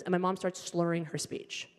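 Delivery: ordinary delivery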